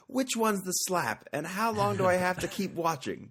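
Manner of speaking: high, drawn-out quote impression; John Oliver. Quoting a comedian on a TV show, high slow impression. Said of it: "as John Oliver said".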